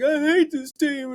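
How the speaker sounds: put-on very high, slow, loud wailing kid voice; crying kid. Little kid sobbing over the Jets trading his heroes. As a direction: wail it like a heartbroken child. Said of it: "He's like, and he's crying".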